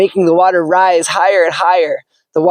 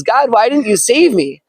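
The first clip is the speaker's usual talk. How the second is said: higher, mildly hurt question; reproachful question; 6 semitones higher than usual. Acted. Drowned man asks God why he wasn't saved, mildly reproachful. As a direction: ask God why, a little wounded.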